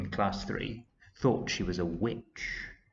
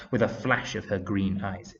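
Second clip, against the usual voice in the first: ordinary delivery, nothing marked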